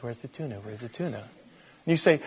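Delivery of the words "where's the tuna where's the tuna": small, soft questioning cat; oblivious cat. Acted. Preacher voices the cat missing the tuna, soft oblivious cat. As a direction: play a clueless cat under the voice. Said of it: "And the cat says".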